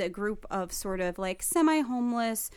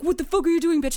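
louder, higher mock-angry challenge; aggressive threat; 6 semitones higher, 5 dB louder, faster than usual. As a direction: imitate a man squaring up for a fight. Crackhead coming at her, retold.

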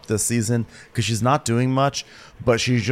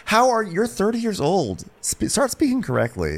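exasperated scolding; 11 semitones higher than usual. Acted. Telling a grown man off for his speech, exasperated.

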